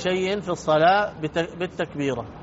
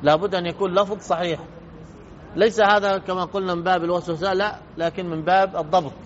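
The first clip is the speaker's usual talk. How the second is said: ordinary talk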